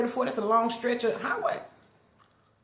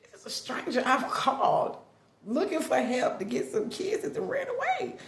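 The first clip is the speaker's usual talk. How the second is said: ordinary talk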